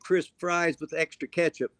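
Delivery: usual speaking voice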